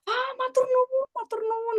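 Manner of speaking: very high-pitched, acted thanks; imitated grateful vendor. Vendor thanking child for coffee, high imitated gratitude.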